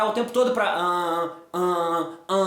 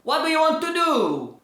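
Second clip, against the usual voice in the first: another English accent; 5 semitones higher, slower than usual. Shown as a demonstration. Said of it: "Tá tudo com sotaque diferente".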